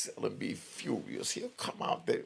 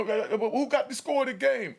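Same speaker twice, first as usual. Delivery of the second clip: higher, louder shouted question; imitated yelling; 12 semitones higher, 5 dB louder than usual. Cell gangster yelling a question across the range. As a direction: call it out over everyone. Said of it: "And he'll just start yelling".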